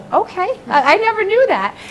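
I never knew that, marvelling; amused surprise. Real, not acted. Nurse amazed by her dad's funeral wish, high and loud.

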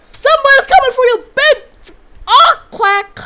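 high, loud threat; shouted taunt at the enemy. Real, not acted. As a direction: yell a gleeful threat.

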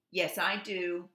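loud, indignant storybook retort; mildly shouted comeback; crying out. A narrator's reading. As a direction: cry it out as a protesting goose. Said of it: "Goose cried out".

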